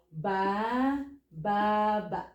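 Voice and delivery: three separate, lengthened bas; teacher spacing out each ba; syllable by syllable. Shown as a demonstration. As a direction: say "ba, ba, ba" slowly with a gap after each. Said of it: "Three bas".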